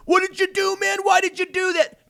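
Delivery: high, whiny mock voice of the crowd; shrill-sounding sarcastic imitation of outraged people; mocking bystanders.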